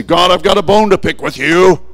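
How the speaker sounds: loud indignant mock grievance; mock complaint. Believer at the throne confronting God, mock indignant. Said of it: "show up at the throne and say".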